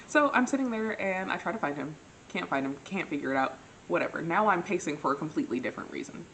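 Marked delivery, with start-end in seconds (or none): none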